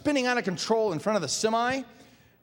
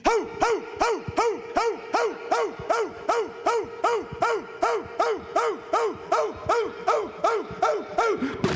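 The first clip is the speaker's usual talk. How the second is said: high, rapid repeated barks; imitated relentless dog yapping; Dakota City dogs barking; 13 semitones higher than usual. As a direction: bark on and on like neighborhood dogs. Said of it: "my impression of Dakota City in the evening".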